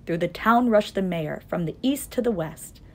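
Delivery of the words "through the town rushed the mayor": ordinary delivery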